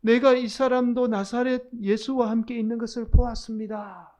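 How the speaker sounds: loud outcry. Acted. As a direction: call it out so everyone hears. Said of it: "그렇게 큰 소리로 외치게 되죠".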